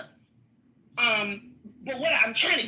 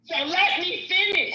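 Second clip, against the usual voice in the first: yelling to be heard, raised pitch; loud sharp voice; 6 semitones higher than usual. Real, not acted. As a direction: demand the floor, loud and sharp. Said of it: "Why you yelling?".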